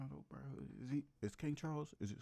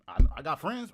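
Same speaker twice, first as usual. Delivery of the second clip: loud blurt; 13 dB louder than usual. Real, not acted. Blurts out loudly, then apologises.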